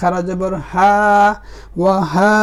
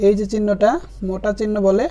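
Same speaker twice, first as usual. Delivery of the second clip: ordinary delivery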